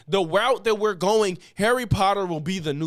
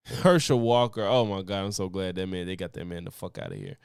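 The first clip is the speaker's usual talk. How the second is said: usual speaking voice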